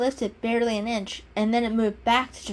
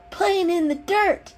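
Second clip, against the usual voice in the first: much higher, slow gleeful exclamation; exclaiming; 10 semitones higher, 4 dB louder, slower than usual. A narrator's reading. Kitten gleefully answering the owner, exclaiming. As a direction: shout it out with glee. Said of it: "Mister Fluffles exclaimed".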